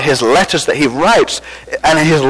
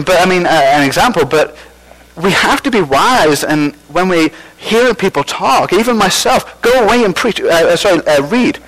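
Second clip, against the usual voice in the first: ordinary delivery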